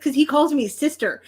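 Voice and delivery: ordinary talk, nothing marked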